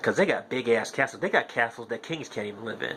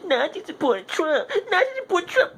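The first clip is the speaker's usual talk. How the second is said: mocking whine. Mocking people crying about Nazis.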